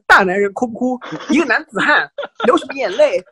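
laughing mock scold. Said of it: "她每次会讲那种话".

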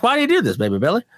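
quote, up high on do; mock fan asking Baby Billy; Baby Billy bit. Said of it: "People ask".

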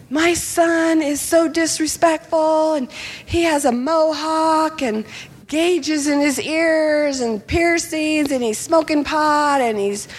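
high, drawn-out mock lament; exasperated complaint. Lady in England complaining about her son, imitated exasperation. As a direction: list grievances high and slow.

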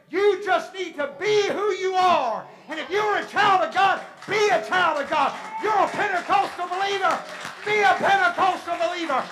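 high, shouted exhortation in punched phrases; full-force preaching. Real, not acted. Preacher urging Pentecostal believers to be themselves, shouting. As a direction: roar it out to the congregation.

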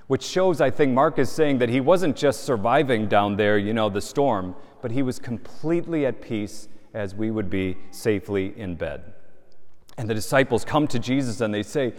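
normal talking voice

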